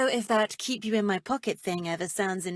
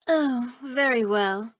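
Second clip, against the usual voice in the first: ordinary delivery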